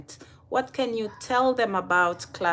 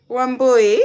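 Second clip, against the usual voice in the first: laughing call; 7 semitones higher, 4 dB louder than usual. Real, not acted. Teasing a friend by name.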